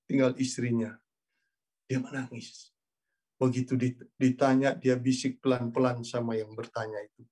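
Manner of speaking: ordinary delivery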